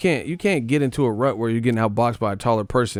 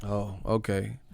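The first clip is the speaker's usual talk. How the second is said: ordinary talk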